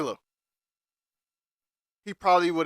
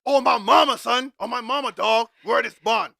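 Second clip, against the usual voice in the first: much higher, loud mock New York bro; New York slang impression; 8 semitones higher, 6 dB louder than usual. Mocking Rob advising Michael, squeaky hyped New York voice. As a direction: ham up a guy trying to sound New York. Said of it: "getting all New York".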